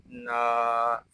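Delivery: ना with a long drawn aa; one-alif stretch. Shown as a demonstration. Teacher shows the alif madd, drawn-out ना. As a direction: stretch the vowel of ना. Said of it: "एक अलिफ तक खींच कर पढ़ेंगे".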